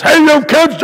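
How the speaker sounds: high, mock angry yell of a grumpy man; retold shout. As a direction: imitate a grumpy neighbour yelling at kids. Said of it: "he would shout at us".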